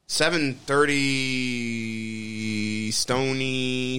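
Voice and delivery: very slow, stretched-out syllables; halting, slow-motion recital; drawn out. Real, not acted. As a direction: drag out each word of the address. Reciting address slowly while searching.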